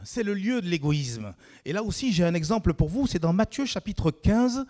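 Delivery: rising sermon fervour; fervent preaching. Acted. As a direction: preach it out to the whole church. Preaching raises to full force.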